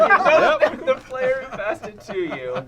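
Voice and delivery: loud, slow, laughing card read; dramatic card reading. Acted. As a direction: read the card like a grand proclamation. Hammed-up reading of card text.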